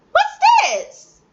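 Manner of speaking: very high squeaky echo; child repeating the question; kid echoing. Retold or imitated. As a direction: parrot the question like a toddler. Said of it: "And they might go".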